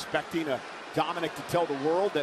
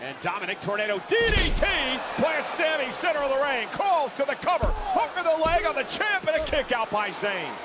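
excited, higher play-by-play call; wrestling commentator; 6 semitones higher than usual. Real, not acted. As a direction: call the big moves as they land. Live play-by-play of the match.

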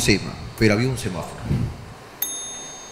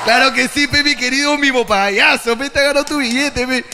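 mock grand generosity; 5 semitones higher than usual. Rewarding the mime to hide his fear, grandly. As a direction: play the big shot handing out cash.

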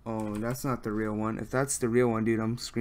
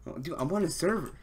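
ordinary talk, nothing marked

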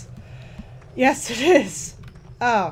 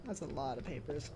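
quiet repeat; 6 semitones lower, 16 dB quieter than usual. Real, not acted. Repeats line, much softer.

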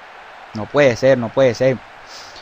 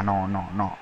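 quiet despair; 8 semitones lower, 12 dB quieter, slower than usual. Real, not acted. Conceding a goal.

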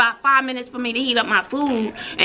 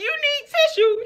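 high shocked. Retold or imitated. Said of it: "I was like".

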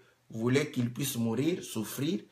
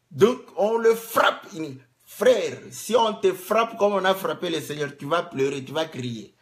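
louder, higher preaching push; forceful preaching; 4 semitones higher, 6 dB louder than usual. Acted. Preacher warning the congregation, loud and raised. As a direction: drive it home to the church.

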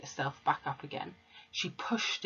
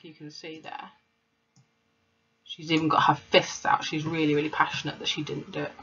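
plain reading voice

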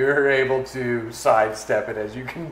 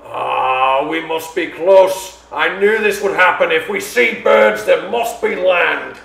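high, loud, fervent captain; excited ship's captain; 5 semitones higher, 8 dB louder than usual. Acted. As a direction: proclaim it as a captain sure that land is near. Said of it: "the captain rushes onto the deck".

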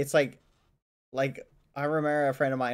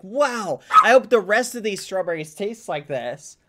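delighted; 4 semitones higher, 6 dB louder than usual. Retold or imitated. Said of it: "and you're like".

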